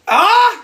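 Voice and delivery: high, loud excited squeal; 1 delighted squeal. Real, not acted. Hoping the legendary mother saw, she squeals with delight. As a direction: squeal with glee.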